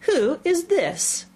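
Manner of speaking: slow, high, stern demand; severe. A narrator's reading. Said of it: "the Queen said severely".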